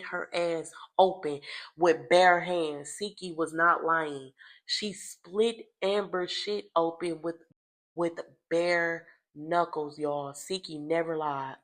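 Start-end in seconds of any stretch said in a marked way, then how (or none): none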